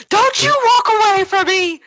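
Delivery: high, loud angry scolding; family row. Acted. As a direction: yell after her as she leaves. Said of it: "Your girlfriend's bitching at ya".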